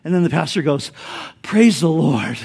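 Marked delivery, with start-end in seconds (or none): 1.4-2.4 calm praise after a narrow escape; relieved and calm. Retold or imitated. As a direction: breathe out in relief, no longer shouting. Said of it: "the horse stops right at the edge".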